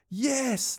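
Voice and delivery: high-pitched cry of triumph; excited scream. A narrator's reading. Louis celebrates his friend's goal, screaming with excitement. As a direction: cheer it out high and long. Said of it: "Louis screamed".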